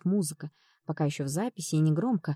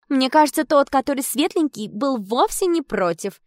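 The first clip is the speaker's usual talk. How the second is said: eager agreeing; 7 semitones higher, 7 dB louder than usual. Acted. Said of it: "поддакнула Наташка".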